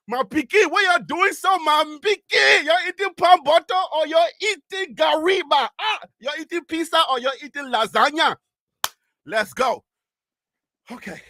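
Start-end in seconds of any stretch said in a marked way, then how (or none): 0.1-8.4 high, loud Liberian English. Acted. Host throwing in Liberian English for viewers, high and loud. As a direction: call out in excited Liberian English. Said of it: "Liberian English, like".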